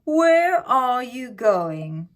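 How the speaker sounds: slow, drawn-out menacing wolf; the big bad wolf sizing up his prey; sly wolf voice. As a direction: stretch it out like a hungry wolf. Wolf questions girl.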